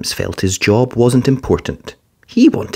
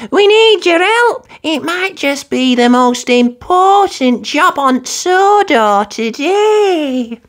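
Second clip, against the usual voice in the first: very high, slow, louder voice; urgent plea; 3 dB louder, slower than usual. Acted. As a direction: plead with him seriously. Nia pleads urgently.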